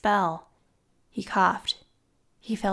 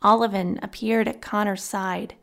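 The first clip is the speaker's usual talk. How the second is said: plain reading voice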